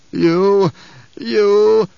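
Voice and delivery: loud choking disbelief; dying gasp. A narrator's reading. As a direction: gasp it out as the poison hits. Poisoned Baron gasps.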